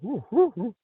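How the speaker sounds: very high falsetto owl hoots. Retold or imitated. Retelling how the call began, high falsetto owl hoots. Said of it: "It started out that".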